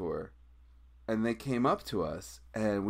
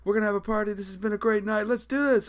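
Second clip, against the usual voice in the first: high, excited crowd-hyping voice; mock hype announcement; 11 semitones higher, 4 dB louder than usual. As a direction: pump the crowd up like an emcee. Someone hyping the crowd to leave.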